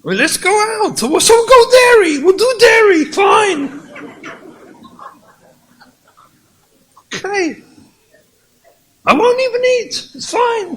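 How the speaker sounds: very high, loud shouting with a bitter laugh; furious shout. Acted. Husband shouting that they will do dairy, furious and loud. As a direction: yell the giving-in like a slammed door. Acted marital quarrel.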